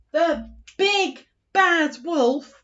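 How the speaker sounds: slow, loud, menacing intro; storyteller introducing the wolf; dramatic announcement. Shown as a demonstration. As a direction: boom out the villain's name.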